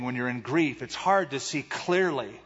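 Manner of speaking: normal talking voice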